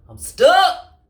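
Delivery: high, loud panicked yell; panicked cry. Acted. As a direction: shout it while trapped. Armadillo trapped in chair.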